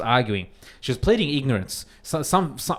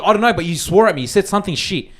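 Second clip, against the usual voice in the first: raised, loud imitation of an angry lady; indignant accusation; 7 semitones higher, 8 dB louder than usual. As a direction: fire the accusation back, higher and louder. Quoting lady in the quarrel.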